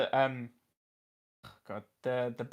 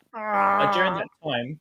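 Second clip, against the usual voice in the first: slow, higher comic voice, lightly done; odd put-on voice; 6 semitones higher, 5 dB louder, slower than usual. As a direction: stretch it out in a silly voice. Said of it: "you're going places with the voice acting".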